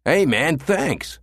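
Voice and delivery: high call after someone leaving; a punk calling thanks to a departing man. A narrator's reading. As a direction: call it after him as he runs off. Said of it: "the punk called after him".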